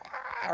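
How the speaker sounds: very high, thin mock scream; imitated scream. Imitating people heard in the maze, high mock scream. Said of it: "you can hear people screaming".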